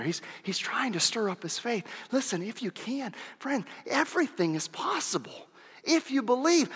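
hushed, breathy whisper to the crowd; soft sermon whisper. Acted. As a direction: lean in and whisper it like a secret. Pleading intimately with the congregation.

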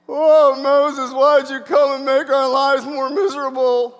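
loud, high whine at Moses; hammed-up grumbling of an aggrieved crowd; mock complaining. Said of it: "we're grumbling and complaining".

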